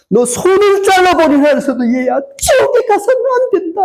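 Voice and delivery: very high-pitched preacher's cry; pleading outcry. Acted. God cries to his child to stay out of hell, anguished outcry. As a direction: cry it out far above normal pitch, desperate. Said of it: "이렇게 외치는 거예요".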